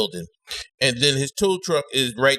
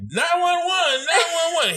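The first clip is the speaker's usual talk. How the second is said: mock panicked call; 13 semitones higher than usual. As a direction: yell for help in a put-on panic. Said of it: "I'm gonna be telling, like".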